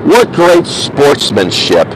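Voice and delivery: slow, sarcastic drawl of praise; a lightly voiced friend mocking sportsmanship. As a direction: stretch it out, dripping with irony. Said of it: "when Tyler said".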